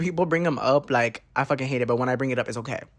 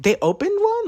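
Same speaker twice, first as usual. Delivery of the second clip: very high-pitched astonishment; acted-out squeaky amazement; surprised question. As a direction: let the voice leap up in surprise. Acts out surprise at new shop.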